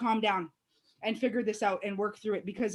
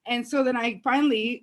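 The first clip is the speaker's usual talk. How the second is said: ordinary talk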